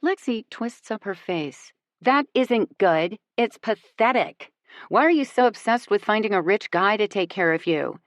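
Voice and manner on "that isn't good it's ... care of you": snarky. A narrator's reading. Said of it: "the snark that Lexi's did".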